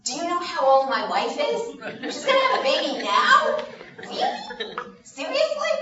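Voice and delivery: astonished, half laughing at the news; disbelieving surprise. Acted. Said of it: "but then he said".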